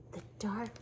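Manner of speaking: very soft, hushed reply; whispered. A narrator's reading. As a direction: confess the fear under your breath. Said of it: "whispered Rabbit".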